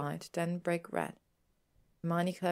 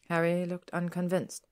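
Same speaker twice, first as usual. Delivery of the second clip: usual speaking voice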